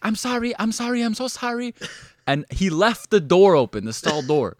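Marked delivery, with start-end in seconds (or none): 0.0-1.7 high, rushed mock apology; flustered apology. Caught-out coworker fleeing, high flustered apology. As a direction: squeak out a panicked sorry. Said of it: "He's like".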